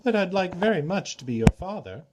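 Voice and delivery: high, teasing trickster voice; a sly god offering to be her father; Klepnos, playful trickster god. Acted. Trickster god to Esme, teasing.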